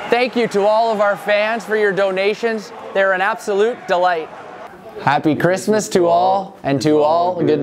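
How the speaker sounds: cheery exclamation. Acted. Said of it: "I heard him exclaim".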